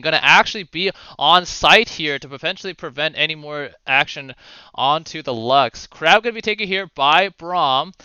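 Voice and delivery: animated esports caster; live play-by-play. Acted. Caster to viewers as hooks fly in bot lane, animated play-by-play. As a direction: follow the fight in running commentary.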